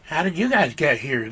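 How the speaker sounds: ordinary delivery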